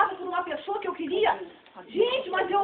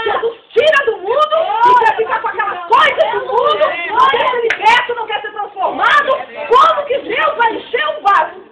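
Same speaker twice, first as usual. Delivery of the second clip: preaching at full force; 3 semitones higher, 11 dB louder than usual. Real, not acted. Preacher to the sisters about the vessel, in a fervent preaching shout.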